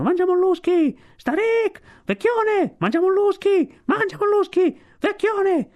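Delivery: very high squawking bird cries; high-pitched jeering yells; shrieking. A narrator's reading. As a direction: shriek taunts like a flock of seabirds. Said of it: "gridavano e strillavano".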